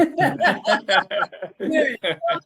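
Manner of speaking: high, slow laughing repeat. Real, not acted. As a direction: laugh through the words.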